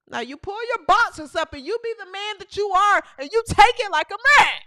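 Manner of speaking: usual speaking voice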